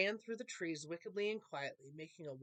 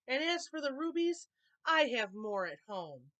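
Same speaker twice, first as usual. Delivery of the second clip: sulky elf; 6 semitones higher, 6 dB louder than usual. A narrator's reading. Elf's sore-loser parting shot.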